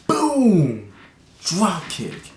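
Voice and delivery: high, loud yell on boom; mock battle yell. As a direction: shout it like landing the kick. Acting out the drop kick.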